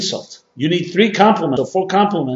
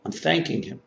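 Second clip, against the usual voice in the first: quiet; 2 semitones lower, 11 dB quieter than usual. Retold or imitated. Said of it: "begins to mumble something quietly".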